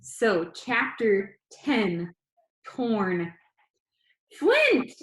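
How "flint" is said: high, loud yell of a name; shrill call. Acted. Grandma calling her grandson from down the hall, shrill and loud. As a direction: call him sharply from down the hall. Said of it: "a shrill voice rang from down the hall".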